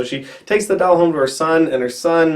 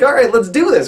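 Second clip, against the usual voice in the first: high, louder, mock-eager suggestion; eager doll; 8 semitones higher, 4 dB louder than usual. Killer doll to horrified kids, mock eagerness. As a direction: play an over-keen doll pitching a plan. Chucky eagerly proposes a killing.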